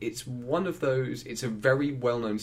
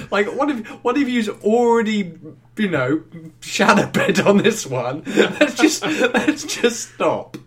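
high, loud, laughing exasperation; exasperated mock plea; 9 semitones higher, 8 dB louder, slower than usual. Passenger telling the carriage to stop, mock-exasperated shout. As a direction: plead loudly through laughter.

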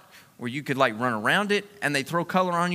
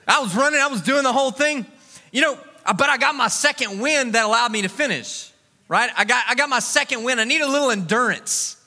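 raised-pitch, emphatic sermon delivery; preaching; 6 semitones higher than usual. Real, not acted. Preacher turning his race story into scripture, emphatic preaching. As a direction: preach it out, pitched up and driving, stretching key words.

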